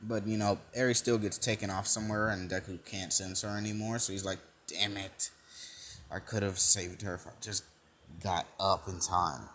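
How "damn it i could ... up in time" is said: low, subdued self-reproach; muttered regret. Retold or imitated. Deku blames himself for not saving her, muttered regret. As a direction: quietly kick yourself for being late.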